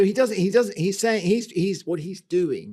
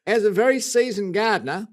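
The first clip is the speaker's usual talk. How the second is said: put-on emphatic, louder Australian accent; 3 semitones higher, 5 dB louder than usual. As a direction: grow into a hammy Aussie letter-writer. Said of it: "you're growing into it".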